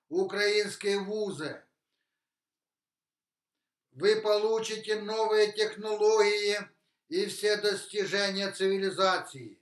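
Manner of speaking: ordinary talk, nothing marked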